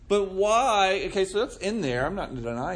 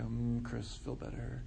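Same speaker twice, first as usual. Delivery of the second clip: mock soothing; 5 semitones lower, 12 dB quieter than usual. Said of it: "I can't go".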